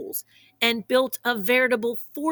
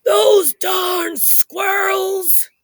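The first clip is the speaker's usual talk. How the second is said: put-on high, slow cranky old-man shout; grumpy old man yell; 11 semitones higher, 6 dB louder, much slower than usual. Old man shouting at the squirrels, high cranky yell. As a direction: yell it like a grump shaking his fist. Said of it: "he shook his old man fist and he yelled".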